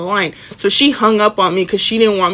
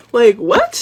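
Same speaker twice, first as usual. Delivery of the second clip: very high questioning "what"; baffled, high-pitched challenge; incredulous; 13 semitones higher, 3 dB louder than usual. Retold or imitated. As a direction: shoot up in pitch with disbelief. Disbelief at being watched.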